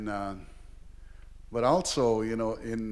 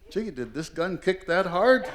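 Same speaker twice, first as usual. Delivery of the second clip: high, loud puzzled question; imitated astonished uncle; acted bafflement; 7 semitones higher, 5 dB louder than usual. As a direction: ask in mock amazement as the uncle. Uncle baffled by dropped pants.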